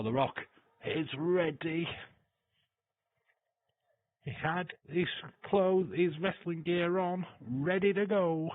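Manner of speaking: high, loud, drawn-out hype; hyping up the wrestler's entrance; hyped. Real, not acted. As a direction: build it like a ring entrance.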